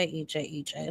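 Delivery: usual speaking voice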